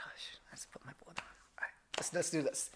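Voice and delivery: very soft, resigned mutter; muttered aside. Retold or imitated. Son bracing for dad's riddle, muttered aside. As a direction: grumble it under the breath.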